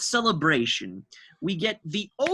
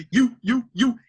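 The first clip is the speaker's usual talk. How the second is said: louder, higher imitation of the sampled "you"; imitating sample; 7 semitones higher, 6 dB louder than usual. Mimicking the [name] sample to his co-host, loud and pointed. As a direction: echo the sample back, punching each "you". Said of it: "where it goes".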